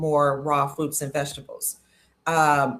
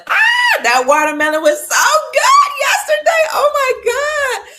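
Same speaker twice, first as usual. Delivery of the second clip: much higher, loud delighted yelling; excited shout; 10 dB louder than usual. Real, not acted. Host raving to viewers about yesterday's watermelon, excited loud shout.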